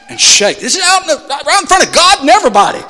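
high, loud, incredulous sermon telling; excited preaching. Acted. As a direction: marvel loudly at the scene. Retelling the convulsing warlock.

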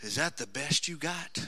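low, soft challenge; hushed, menacing dare; quiet taunt. Acted. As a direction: say it quietly right in his face. He goes up into George's face, and he says.

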